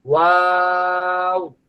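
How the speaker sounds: ওয়াও slow with rounded lips; drawn-out waw. Shown as a demonstration. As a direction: model ওয়াও slowly. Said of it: "ঠোঁট গোল থেকে শুরু হবে". (গোল ঠোঁটে ওয়াও)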